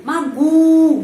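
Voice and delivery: magu loud, stress on the U, O sounding like A; loud stress demo. Shown as a demonstration. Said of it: "penekanannya pada huruf U".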